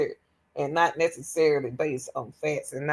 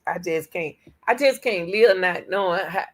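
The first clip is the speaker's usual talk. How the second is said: ordinary talk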